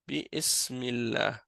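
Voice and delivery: "bi ismillah" spelled out slowly, i sounded; slow written form. Shown as a demonstration. Teacher slowly revealing the written form behind bismillah. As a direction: stretch "bi ismillah" to show the hidden alif. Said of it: "Mais en réalité, c'est".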